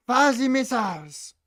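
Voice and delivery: exaggerated French accent, slow and high; bad French accent. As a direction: copy a cheesy French voice slowly. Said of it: "how to do a French accent. And a shit one".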